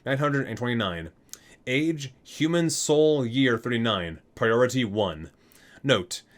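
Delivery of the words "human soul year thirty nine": ordinary delivery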